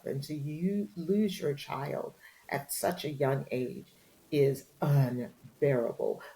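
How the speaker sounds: usual speaking voice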